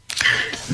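a quiet breathy laugh at himself; soft laugh fighting back tears; soft embarrassed laugh. Real, not acted. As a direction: laugh softly, a little embarrassed. Embarrassed laugh at own emotion.

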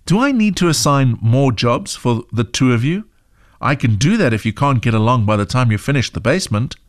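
ordinary delivery

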